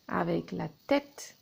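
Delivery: ordinary delivery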